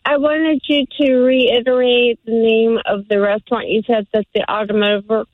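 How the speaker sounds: ordinary talk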